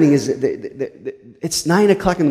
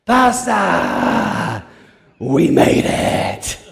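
put-on proud drunks; much slower than usual. Drunks arriving at church.

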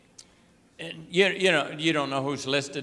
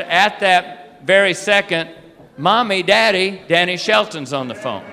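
excited calling out; 3 semitones higher, 7 dB louder than usual. Retold or imitated. Girl shouting for parents, retold.

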